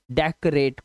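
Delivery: डेकोरेट as "dek-rate", middle vowel swallowed; correct pronunciation. Shown as a demonstration. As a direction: model डेकोरेट slowly with no "o" after the k. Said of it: "को नहीं, करेट".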